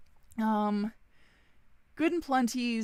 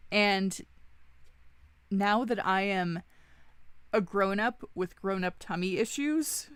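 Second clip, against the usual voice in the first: normal talking voice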